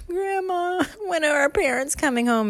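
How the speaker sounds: put-on high, whimpering child; a touch of crying kid; mock crying kid. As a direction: whine it near tears. Kids crying to grandma.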